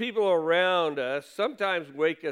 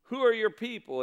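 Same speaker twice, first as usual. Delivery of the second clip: higher, urgent rapid-fire questions; frightened sailors grilling Jonah, higher and pressing; sailors' bombarding questions; 5 semitones higher than usual. Retold or imitated. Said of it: "they bombard him with questions".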